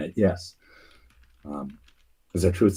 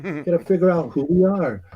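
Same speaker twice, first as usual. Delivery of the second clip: put-on weak British accent; 10 semitones higher than usual. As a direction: ham up a posh British knight, high-pitched. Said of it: "I used my weak British voice".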